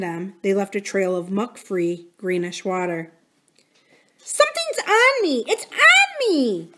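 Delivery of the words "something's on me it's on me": high, panicked shriek; terrified scream. A narrator's reading. Drew screams at the others in panic, high shriek. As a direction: shriek in terror at the slime. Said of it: "Drew screamed".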